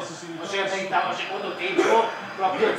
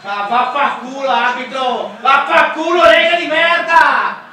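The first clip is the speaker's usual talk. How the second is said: explosive Italian cursing; 3 semitones higher, 7 dB louder than usual. Real, not acted. Fan cursing the referee and league.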